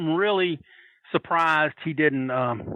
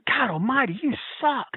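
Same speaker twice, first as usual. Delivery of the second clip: retold heckling; 8 semitones higher than usual. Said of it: "like, hammering him".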